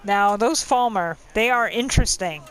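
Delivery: usual speaking voice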